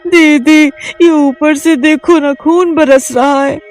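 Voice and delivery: high, loud frightened voice; frightened younger sister; scared pleading. Acted. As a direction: beg her to look up.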